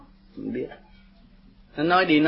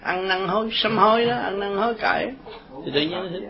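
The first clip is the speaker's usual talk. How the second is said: normal talking voice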